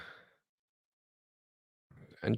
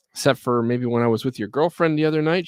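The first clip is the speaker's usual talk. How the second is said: ordinary delivery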